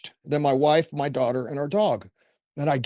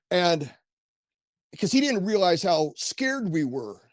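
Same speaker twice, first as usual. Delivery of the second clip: usual speaking voice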